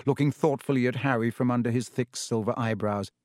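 plain reading voice

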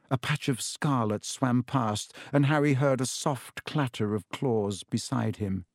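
usual speaking voice

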